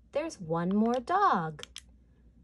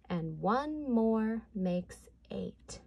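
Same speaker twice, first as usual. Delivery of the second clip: ordinary talk, nothing marked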